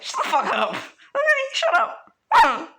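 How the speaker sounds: furious yell that leaps high and loud; angry shout. Pretend. Bakugo snapping at Izuku, shrieking angry yell. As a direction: blow up at him, shrieking.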